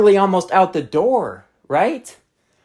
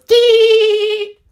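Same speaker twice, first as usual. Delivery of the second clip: put-on high, squeaky toddler shout; imitating a toddler; 5 dB louder than usual. Toddler shooting her with a Nerf gun, squeaky high yelp. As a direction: yelp it like a three-year-old firing a toy gun.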